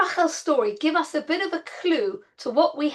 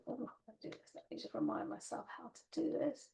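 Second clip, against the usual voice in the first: much softer, half-whispered to herself; muttered aside; 17 dB quieter than usual. Real, not acted. Teacher sorting out the screen, muttering softly to herself. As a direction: mumble it under your breath while fiddling.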